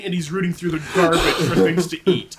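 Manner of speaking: normal talking voice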